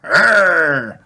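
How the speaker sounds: high, loud playful growl; small child roaring back; long mock-fierce arr; toddler's dinosaur roar. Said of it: "replies George".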